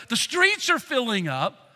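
normal talking voice

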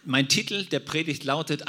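ordinary talk, nothing marked

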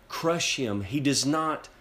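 ordinary talk

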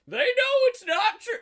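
very high, loud mock movie voice; Chris Pratt. Quoting the trailer villain to a co-host, exaggerated high impression. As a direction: squawk it out as a hammed-up impression. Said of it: "That's my Chris Pratt voice".

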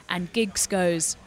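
normal talking voice

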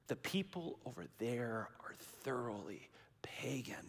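whispered aside, voiceless; a conspiratorial whisper about the pagans; hushed whisper. Acted. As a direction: lean in and whisper it like a secret.

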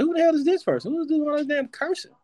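high, squeaky mock disbelief; mock bewildered. Host mimicking his reaction to the co-host, high incredulous voice. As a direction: act baffled by a stranger in a high voice. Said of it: "I was like".

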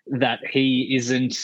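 ordinary delivery

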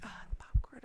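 small whispered worry; kid whisper. Retold or imitated. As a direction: whisper it, upset about the spill. Kid whispering about spill.

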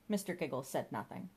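usual speaking voice

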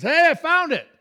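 high, loud call across the woods; excited holler. Retold or imitated. Uncle calling to the hunting party, hollering excitedly. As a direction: holler it out like a big find. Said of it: "starts hooping and hollering".

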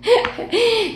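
high amused laugh. Real, not acted. Doctor amused by her own sound effects, laughing. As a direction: laugh at your own silly noise.

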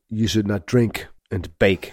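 a lightly voiced deadpan Arnold; mild Arnold impression, deadpan; serious Arnold quote. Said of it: "he's, like, so serious when he says it".

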